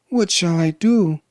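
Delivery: high-pitched plea of a princess; despairing cry. Acted. Princess hears the lions coming, despairing. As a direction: lift the voice in helpless alarm. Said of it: "she cried".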